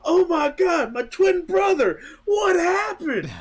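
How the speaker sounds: high, wailing cry over a twin; hammed-up sobbing; mock wailing grief. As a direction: fake anguish over a fallen twin brother. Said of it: "comes in and goes".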